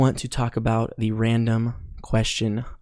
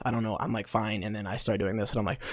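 out of breath; 9 dB quieter than usual. Real, not acted. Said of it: "like, out of breath".

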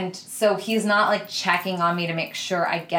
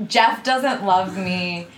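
ordinary talk

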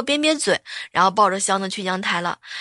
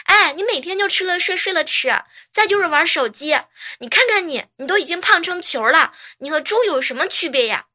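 scolding wife; 6 semitones higher than usual. Retold or imitated. Wife scolding lazy husband.

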